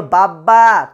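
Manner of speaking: a mother sounding out a word; mother teaching speech. Acted. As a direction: coax a first word out slowly. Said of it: "তোমাকে তোমার মা বলেছিল".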